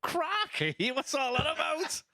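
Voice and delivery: a high, hammed-up stab at an American accent, breaking into a laugh; bad American accent. Commentator trying an American accent on the co-host, laughing. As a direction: play the American accent for laughs and lose it to giggles. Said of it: "we're gonna do this in an American accent".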